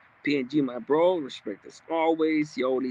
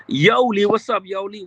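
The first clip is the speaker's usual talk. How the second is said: excited greeting; 5 semitones higher, 7 dB louder than usual. Real, not acted. Greeting a chat viewer.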